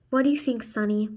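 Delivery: usual speaking voice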